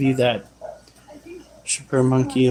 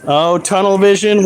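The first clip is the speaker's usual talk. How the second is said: normal talking voice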